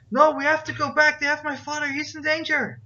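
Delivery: high-pitched, loud frantic protest; panicked kid. Acted. Kid begging to turn back for his father, panicked.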